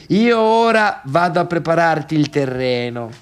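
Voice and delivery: normal talking voice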